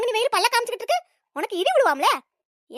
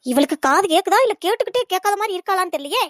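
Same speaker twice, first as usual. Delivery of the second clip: ordinary talk, nothing marked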